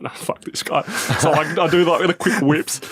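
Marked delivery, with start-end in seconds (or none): 0.0-0.8 whispered, fed up; under breath. Retold or imitated. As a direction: mutter it to yourself.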